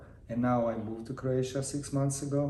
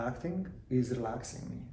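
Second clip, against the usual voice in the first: usual speaking voice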